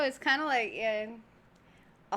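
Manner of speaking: usual speaking voice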